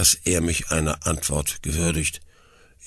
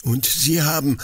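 higher, grim forester's rebuke; grim outburst; 6 semitones higher than usual. A narrator's reading. Forester grimly confronts the shadowless suitor, rebuking. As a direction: start the accusation grimly. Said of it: "fuhr der Forstmeister grimmig wieder an".

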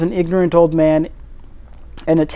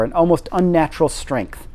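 usual speaking voice

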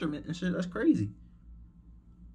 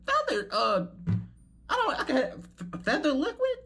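incredulous high voice. Real, not acted. Reacting to painted tongue.

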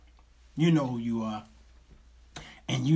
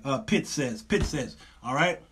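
ordinary delivery

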